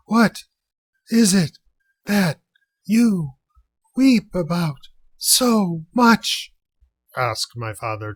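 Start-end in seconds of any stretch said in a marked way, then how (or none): 0.1-6.5 high, loud, drawn-out shout, each word pushed out alone; breathless high shout. A narrator's reading. Father, tossed over the water, shouts up to the rhino: loud and high. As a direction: yell up at the rhino between gasps for breath. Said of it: "trying to get his breath".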